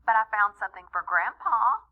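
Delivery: ordinary delivery, nothing marked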